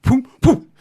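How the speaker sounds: high, loud "poom, poom" knocking sound; voicing a cop knock; imitated knock. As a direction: mimic two heavy door knocks with the mouth. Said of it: "He goes like this".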